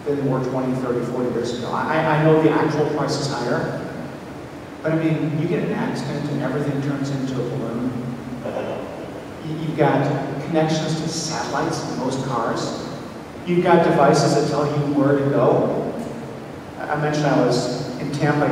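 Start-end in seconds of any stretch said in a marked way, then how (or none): none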